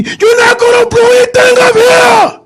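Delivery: high, loud shouted threat; retold drunk yell. Drunk biker yelling in the driver's face, loud shout. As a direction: bark it right in his face. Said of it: "yelled at me".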